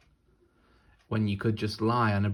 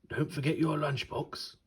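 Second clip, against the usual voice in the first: higher, gentle bear reminder; 6 semitones higher than usual. Acted. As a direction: remind the girl kindly as the bear. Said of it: "said the last bear".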